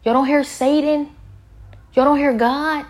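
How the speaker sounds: high, loud incredulous outcry; loud, high disbelieving questions at friends who won't listen; retold exasperated shout. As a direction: yell it at the people laughing, pleading. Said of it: "I said".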